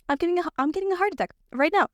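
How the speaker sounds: high-pitched mock alarm; retold panic. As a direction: raise the pitch as if dramatically realizing an emergency. Retells thinking it's a heart attack.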